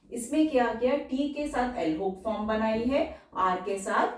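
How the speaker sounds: normal talking voice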